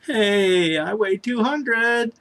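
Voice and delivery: high, drawn-out mock cheer; mock celebration. Sick man announcing his lost weight, high mock celebration. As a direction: sing it out slowly as pretend good news.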